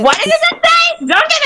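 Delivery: very high-pitched offended question; shrieky indignation. Acted. Customer challenging talk of quitting and Dunkin', indignant. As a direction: jump way up in pitch, affronted.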